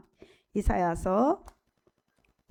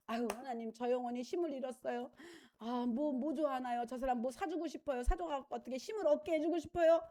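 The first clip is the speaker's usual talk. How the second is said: tender prayer; 8 dB quieter than usual. Retold or imitated. Preacher mimics loving prayer.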